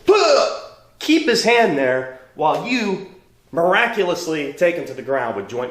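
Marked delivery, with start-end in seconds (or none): 0.1-0.7 sharp high mock karate shout; staged dojo kiai; defender yell. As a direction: snap it out like a block. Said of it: "the other guy's gonna go like this".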